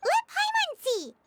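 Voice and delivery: eager. Acted. Paimon eager to peek at list.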